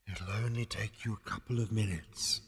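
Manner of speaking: soft, distant ethereal voice; very faint voice. A narrator's reading. Departing voice reassuring Zaphod, very faint. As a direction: say it as if from far away. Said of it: "said the voice very faintly".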